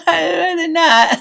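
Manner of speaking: high, laughing squeak. Retold or imitated. Mocking his own timid answer.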